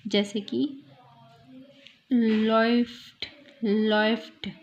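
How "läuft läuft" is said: लॉफ्ट said slowly with an "oy" diphthong, like "loyft"; ओए sound. Shown as a demonstration. Teacher blending two vowel sounds, slow "oy" in लॉफ्ट. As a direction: glide through "oy" in लॉफ्ट, drawn out. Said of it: "मिला के ओए".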